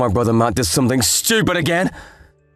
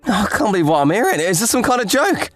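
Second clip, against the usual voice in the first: aghast at the news; incredulous; faster than usual. Acted. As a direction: react in stunned disbelief. Brother-in-law shocked by the news.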